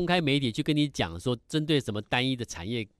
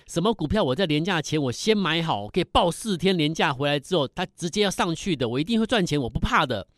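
higher-pitched, mildly loud bold claim; bold declaration; 6 semitones higher, 3 dB louder than usual. Acted. Host voicing a viewer who dares promise profit, bold and loud. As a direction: act out someone declaring it boldly, pitched up. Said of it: "勇敢的那么大声的告诉我说".

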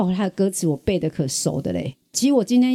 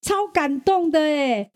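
higher and slow, savoring the feeling; deeply moved; 8 semitones higher, slower than usual. Real, not acted. Host tells guest how touched she was, slow and moved. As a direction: let the emotion lift the words.

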